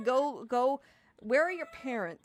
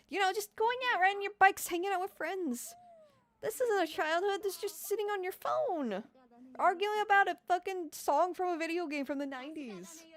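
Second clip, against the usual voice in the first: much higher, louder lecture; heated rant; 8 semitones higher, 4 dB louder than usual. Real, not acted. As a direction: get worked up preaching. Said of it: "getting heated".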